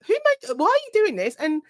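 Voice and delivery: high-pitched mock dismay; incredulous demand, starting very high; retold appalled questioning. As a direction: act out questioning a teenager in disbelief. Retells scolding a work experience kid.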